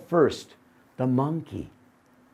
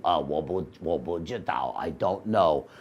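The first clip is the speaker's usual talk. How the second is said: dopey donkey; 10 semitones lower, 5 dB quieter than usual. Acted. Donkey fumbling for its name.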